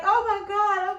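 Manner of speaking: high, loud tearful cry; distressed oh my God. Retold or imitated. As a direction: cry out in dismay. Retells crying to mother.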